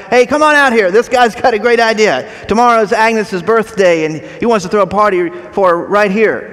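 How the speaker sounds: loud, high call toward the kitchen; shouting to his wife. Retold or imitated. Diner owner calling his wife from the counter, loud and excited. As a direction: call out to the back room, excited. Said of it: "shouts to his wife".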